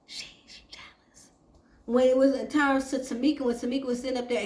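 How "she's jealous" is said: barely voiced, slow whisper; mouthed aside. Mother covertly mouthing it to Tasha, whispered imitation. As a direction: imitate someone mouthing words silently. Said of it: "mouthed to Tasha that".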